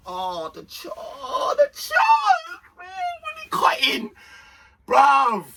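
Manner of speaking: high strained cry of frustration over a missed chance; anguished cry. Real, not acted. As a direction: wail it out as the chance goes begging.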